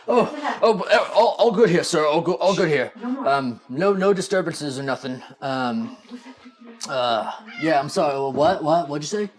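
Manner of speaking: a dim guard snapping awake, flustered. Acted.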